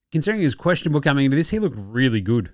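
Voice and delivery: ordinary talk, nothing marked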